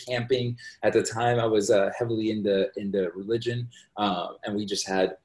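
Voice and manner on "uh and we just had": ordinary delivery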